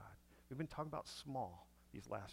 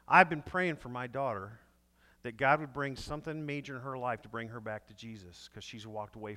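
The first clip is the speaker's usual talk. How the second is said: louder, preaching the father's testimony; 9 dB louder than usual. Retold or imitated. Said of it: "he begins this sermon".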